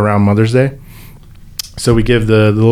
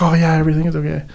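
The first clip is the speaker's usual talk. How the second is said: higher, hurried brush-off; retold dismissal of concern; imitated quick reassurance; 7 semitones higher, much faster than usual. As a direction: reassure too quickly, in a raised pitch.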